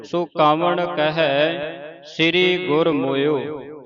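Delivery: loud, slow verse recitation; slow, full-voiced devotional reading; scripture recitation. Shown as a demonstration. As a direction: recite firmly with long drawn-out vowels.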